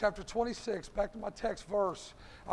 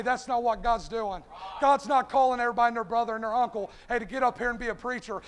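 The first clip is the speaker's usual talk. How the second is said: ordinary talk, nothing marked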